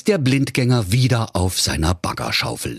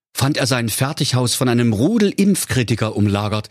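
ordinary delivery, nothing marked

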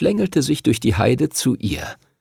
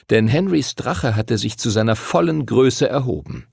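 ordinary delivery

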